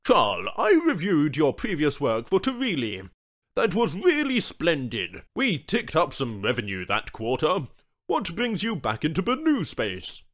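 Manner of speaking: a corporate man making small talk; chatty businessman. Acted. The suit addresses Charles.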